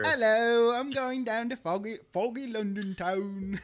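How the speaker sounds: hammy Cockney-style London voice; playful put-on London accent, slowing at the end. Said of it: "his London accent".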